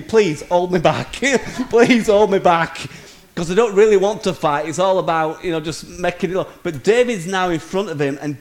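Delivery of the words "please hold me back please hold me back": louder, slow, a laugh in the middle; mock pleading. Preacher voicing the bully's silent plea, loud hammed-up begging. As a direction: beg to be held back, half joking. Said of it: "we're just thinking".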